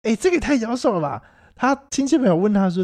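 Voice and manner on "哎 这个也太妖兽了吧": mock exclaiming. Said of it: "E先生告诉我说".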